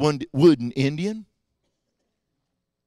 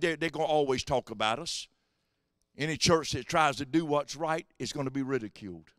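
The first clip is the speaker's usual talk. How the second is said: ordinary talk, nothing marked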